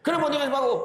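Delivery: hammed-up angry husband scolding his wife. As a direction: snap at the wife in mock anger. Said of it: "Dia marah".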